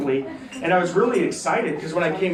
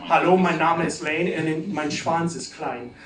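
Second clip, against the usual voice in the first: German phrase. Retold or imitated. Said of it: "when I introduced myself and said".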